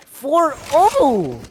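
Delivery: hyped, high round call; excited shout. Real, not acted. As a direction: yell the score like a caster. Caster calls the round score.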